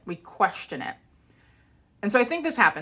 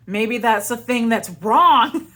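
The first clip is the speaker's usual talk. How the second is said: mock revelation; 5 semitones higher, 10 dB louder, slower than usual. Mock dramatic realization.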